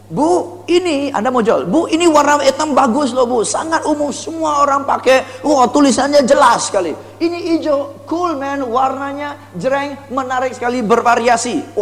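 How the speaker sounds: high, gushing product praise; overpraising sales pitch. Shown as a demonstration. Salesperson selling to a customer, gushing that everything is good. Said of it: "terlalu nyanyiin kehebatannya".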